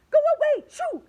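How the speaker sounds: very high, very loud yell; frantic shout at a shark. A narrator's reading. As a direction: scream to chase it off. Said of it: "they shouted".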